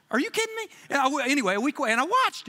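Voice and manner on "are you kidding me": very high exclaim; excited disbelief. Real, not acted. Pastor to Jay in the crowd, high excited disbelief. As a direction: toss it at Jay in delight.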